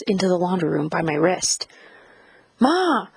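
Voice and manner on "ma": high, rising-falling cry of pain; high protesting yelp. A narrator's reading. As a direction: cry out to mother in pain, pitch leaping up. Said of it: "I cried out in pain".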